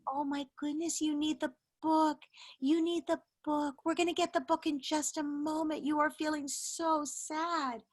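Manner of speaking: put-on calm a crying toddler gently; mother soothing her upset daughter; soothing empathy. Shown as a demonstration. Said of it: "you can just say".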